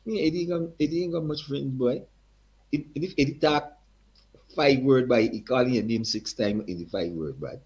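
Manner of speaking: put-on high, hearty Guyanese accent. Eddie's father greets the young singer in a high Guyanese accent. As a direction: greet him like an old Guyanese man. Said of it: "Strong accent again".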